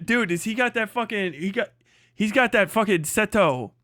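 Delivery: ordinary talk, nothing marked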